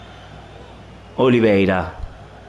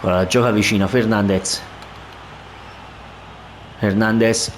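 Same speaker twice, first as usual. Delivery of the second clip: ordinary delivery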